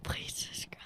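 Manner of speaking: a breathy, voiceless whisper with no tone. Real, not acted. Son whispering the sign-off on dad's podcast. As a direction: whisper it playfully into the mic.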